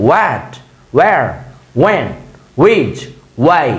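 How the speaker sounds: "what, where, when, which, why" each opening on W; W pronunciation. Shown as a demonstration. Teacher listing question words after the wa drill, W pronunciation. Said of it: "বাকিগুলো ওয়া হবে".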